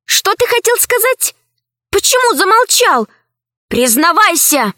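high, insistent questioning; pressing demand. Acted. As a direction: push him to confess. Alice presses the robot.

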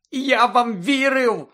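high, loud, slow cracking cry; voice breaking. A narrator's reading. Harry to Lupin, betrayed, voice breaking high and loud. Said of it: "Його голос зривався від хвилювання".

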